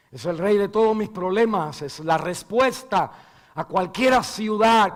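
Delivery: loud, impassioned closing prayer; preaching prayer over music; fervent prayer. Real, not acted. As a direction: pray aloud for the congregation.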